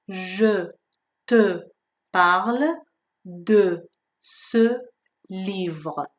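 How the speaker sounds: "Je te parle de ce livre" split into separate words with pauses. Shown as a demonstration. Teacher drilling students on each word: slow, separate. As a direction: drill it word by word with French schwas. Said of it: "تلفظش رو دقت کنید".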